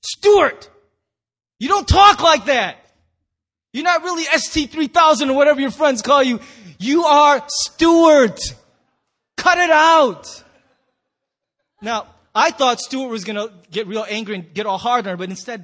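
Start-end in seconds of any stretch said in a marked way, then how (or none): 0.0-10.4 loud, high exasperated outburst; acted furious scolding, stretched out at the end; losing it. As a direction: yell at him like a fed-up girlfriend. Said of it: "she just loses it".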